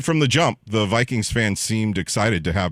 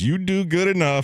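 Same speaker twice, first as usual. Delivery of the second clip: mock caller voice; 10 semitones higher than usual. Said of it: "The caller says".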